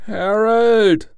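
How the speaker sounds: high, loud drawn-out call of the name; questioning call. A narrator's reading. Wife calls to Harold from bed, questioning call.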